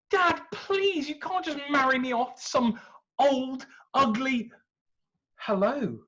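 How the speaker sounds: high, exasperated plea; a teen rolling her eyes at dad; protesting daughter. Acted. Andromeda protesting to her father, high and exasperated.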